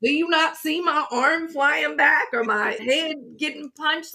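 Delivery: high-pitched mock disbelief; incredulous inner voice. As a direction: act out baffled self-questioning. Acting out bystander's disbelieving inner question.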